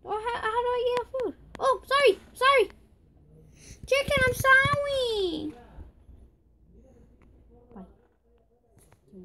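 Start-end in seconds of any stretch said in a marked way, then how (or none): 0.0-5.5 very high, pleading whine; whiny apology. Real, not acted. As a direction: squeal a sorry to a pet, stretching the last one. Apologising to a game chicken.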